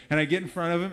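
normal talking voice

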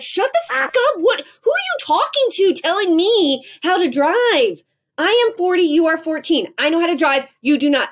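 high, loud, indignant outburst; angry yelling. Retold or imitated. Mother cussing out her teen over driving advice, high angry yell. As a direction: yell it in outraged disbelief. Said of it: "I get louder and louder".

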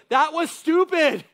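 loud, high, slow angry parent outburst; mad parent. Pretend. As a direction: snap at the kid as a frustrated parent. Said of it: "when you're mad and your kid".

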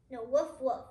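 usual speaking voice

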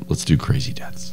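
hushed eager whisper. Acted. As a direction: whisper it like a secret.

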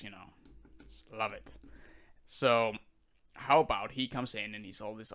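ordinary talk, nothing marked

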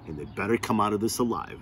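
plain reading voice

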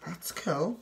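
soft breathy whisper. Real, not acted. Looking at a Christmas train, whispered. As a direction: whisper it like a secret aside.

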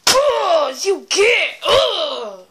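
loud, high startled yell; startled shout. Real, not acted. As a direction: yell as the balloon hits. Shouting at balloon.

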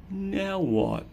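usual speaking voice

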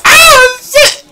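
very high, loud frightened scream; panicked shriek. Real, not acted. Panic while being chased in game.

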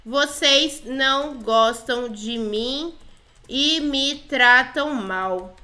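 "Vocês não gostam de mim" very slow, separated word by word; slow dictation. Pretend. Player types "Vocês não gostam de mim" to the girls, slowly dictating. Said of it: "Falei assim".

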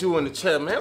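ordinary talk

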